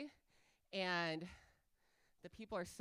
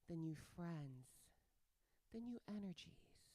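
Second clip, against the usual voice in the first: mock guided meditation; 3 semitones lower, 7 dB quieter than usual. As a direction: guide the crowd calmly, tongue in cheek. Leads a guided breathing exercise.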